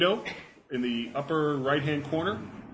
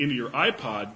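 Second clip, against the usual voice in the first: normal talking voice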